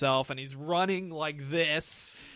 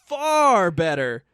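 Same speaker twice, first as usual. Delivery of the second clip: far better, drawn out and loud; stress on far; 3 semitones higher, 9 dB louder, much slower than usual. Real, not acted. Ranting to co-host, far stretched out and loud. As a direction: lean hard on far. Ranting about the Flash movie.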